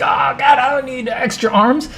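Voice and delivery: high whiny panic; panicked owner. Retold or imitated. Bodega owner whining as arms sprout from him. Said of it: "He's like".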